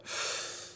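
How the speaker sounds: a long heavy breath on the line; imitated deep breath. Mysterious caller breathes down the phone, heavy breath. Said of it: "I heard a deep breath".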